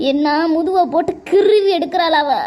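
ordinary talk, nothing marked

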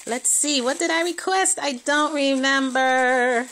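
high, loud, stretched-out thrilled squeal; excited squeal. Real, not acted. Vlogger opening a surprise gift, thrilled high squeal. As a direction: gush with delight as the gift opens.

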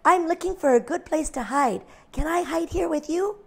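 high, small lamb voice; lightly voiced little lamb. A narrator's reading. Little lamb politely asking the cow for help. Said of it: "said Little Lamb".

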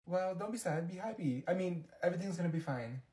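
normal talking voice

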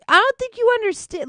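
pleading with her friend in a high squeak; high-pitched plea. Real, not acted. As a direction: squeak it with exasperation.

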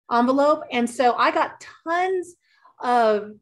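ordinary talk

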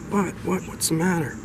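high, startled questions; jolted out of sleep, voice jumping up; startled awake. Acted. Husband shaken awake by his bride, startled.

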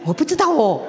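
ordinary talk, nothing marked

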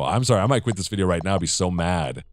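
ordinary talk

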